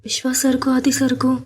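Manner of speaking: a hint of a frightened girl; anxious young woman. A narrator's reading. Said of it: "அவளது உள்ளம் தவித்துக் கொண்டிருந்தது".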